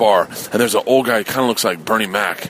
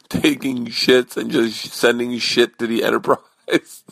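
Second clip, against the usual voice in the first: laughing; slower than usual. Real, not acted. Laughing at his own story.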